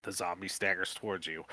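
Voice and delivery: ordinary talk, nothing marked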